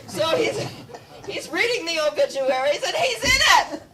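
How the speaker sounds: high, laughing, breaking up; can barely get it out for laughing. Real, not acted. Lecturer amused by Nobel reading his obituary, laughing.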